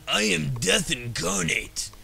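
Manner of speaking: loud, drawn-out overblown boast; mock menacing boast. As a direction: proclaim it like an over-the-top tough guy. Voices the hardest difficulty option.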